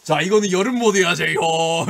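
loud, high, hammed-up showman's announcement; 진영 presenting. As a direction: unveil it at full volume, mimicking a friend. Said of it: "이러면서". (형 흉내 내며 높고 크게 소개)

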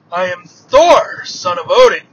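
loud, slow heroic declaration; proud proclamation. Acted. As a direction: announce your titles grandly, drawing them out. Thor proudly announces his titles.

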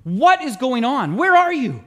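loud, anguished cry to God, slowed on what is going on. Retold or imitated. Ray cries out to God after a week of torment, loud and anguished. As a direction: call out to God, desperate and loud. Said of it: "he's just cries out".

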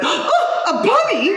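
high, breathless gasp at a bunny; delighted gasp. Retold or imitated. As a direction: squeal over a bunny, mock delight. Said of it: "he says".

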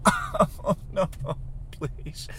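high, voiceless mock whimper; acted squeamish whimper; whimpering dread. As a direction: plead in a tiny panicked breath. Retells bug crawling on him.

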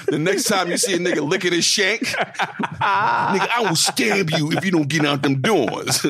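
laughing mock conductor announcement; mumbled subway intercom. Conductor threat over intercom, laughing.

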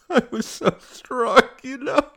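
high, slow, loud tearful wail; slow, high crying voice; suddenly tearful. Acted. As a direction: wail it through welling tears. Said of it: "she said, suddenly tearful".

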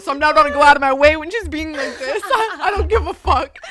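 loud mock-angry read-out, cracking up; angry, half laughing. Reading her own reply back, loud and angry through laughs. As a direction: act out your angry text, laughter leaking in.

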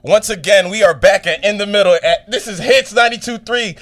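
loud, high hype host; excited announcer. Acted. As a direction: pump up the station slogan. Announcing station slogan loudly.

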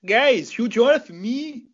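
drawn-out slow humble-fighter thanks; mock fighter addressing his fans; Tom Halpin impression. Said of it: "that's the only impression I can do".

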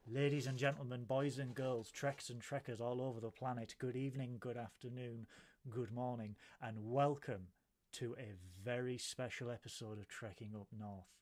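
low, soft, measured welcome; show announcer intro. Acted. Host welcomes Trekkers all over the planet, hushed announcer intro. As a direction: intone the greetings quietly and solemnly.

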